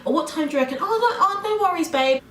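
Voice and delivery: high, breezy sing-song reassurance; easygoing, lilting and high; casual chummy Brit. Retold or imitated. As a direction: imitate a laid-back Brit, pitched well up. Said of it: "We don't speak like that. We'll be like".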